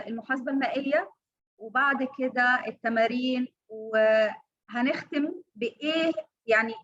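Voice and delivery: usual speaking voice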